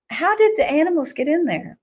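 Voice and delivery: high, fretful mother's voice; worried mother. Retold or imitated. Mother fretting over the animals in the globe, anxious.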